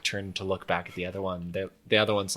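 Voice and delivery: normal talking voice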